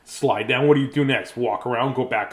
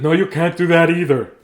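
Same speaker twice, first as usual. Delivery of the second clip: loud, raised-pitch grandpa refusal; stern grandpa; 4 semitones higher, 8 dB louder than usual. Acted. Grandpa stopping child decorating the house, stern. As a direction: forbid it loudly as grandpa.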